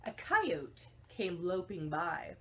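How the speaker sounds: ordinary delivery, nothing marked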